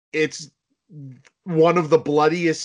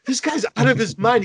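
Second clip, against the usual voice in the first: mock disbelief; 9 semitones higher, 4 dB louder than usual. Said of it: "And you're like".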